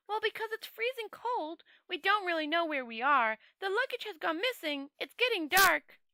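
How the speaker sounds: a naive tourist piling up worries in a high voice; Two Flower fretting. Acted.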